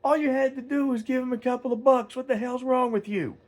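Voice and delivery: high, strained yell across a parking lot; re-enacted yell. Man confronting a rude customer at the gas station, yelling. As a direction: call him out, pitch way up. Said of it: "I yelled, I was like".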